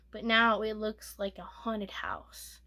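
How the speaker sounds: slow eerie whisper; spooky whisper. Real, not acted. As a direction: hush it like a ghost story. Spooky description.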